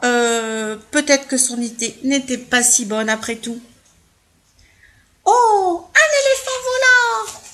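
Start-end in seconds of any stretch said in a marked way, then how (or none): 5.3-7.4 high, loud astonishment; amazed cry. Acted. Onlooker seeing an elephant fly, amazed. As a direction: gasp it out in wonder.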